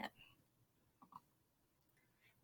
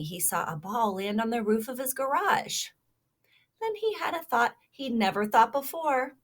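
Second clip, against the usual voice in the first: lively storybook read-aloud; reading to children; 2 semitones higher, 8 dB louder than usual. Acted. Teacher reading a kindness story to children, animated. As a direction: read it like a picture book to little listeners.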